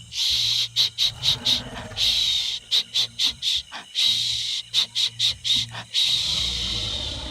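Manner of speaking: long, whispered shh sounds, gentle and steady; soft shushing. Acted. Whispered shushing to calm the dinosaur. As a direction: hush the sleeping T-Rex with soft whispered shh's. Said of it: "Let's calm this T-Rex down by saying, "Shh".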